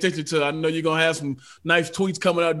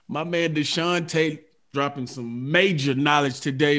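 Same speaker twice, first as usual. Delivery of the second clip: ordinary talk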